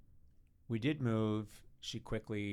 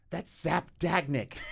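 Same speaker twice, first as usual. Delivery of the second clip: raised, drawn-out announcement of a name; slow, louder and higher, relishing the name; excited quoting; 6 semitones higher, 6 dB louder, slower than usual. Retold or imitated. David naming someone to a baffled friend, excited quoting.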